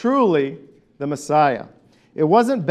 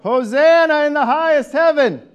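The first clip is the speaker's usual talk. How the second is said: loud, higher cry of praise; shouted call; 9 semitones higher, 4 dB louder than usual. Shown as a demonstration. Pastor leading the Hosanna response, loud praise call. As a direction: lift it up and shout it out. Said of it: "So it shouted like this".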